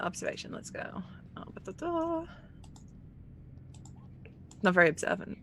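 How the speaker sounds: usual speaking voice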